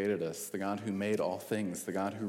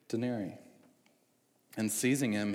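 normal talking voice

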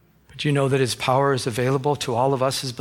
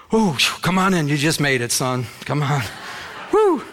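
put-on Southern-accented God. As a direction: play God with a hint of a folksy Southern accent. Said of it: "God has a southern voice".

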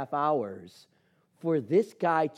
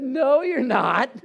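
loud, slow, high refusal; flat refusal; 10 semitones higher, 9 dB louder, much slower than usual. Retold or imitated. To the agent offering a tiny plane, a loud slow refusal. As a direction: drag out a firm no.

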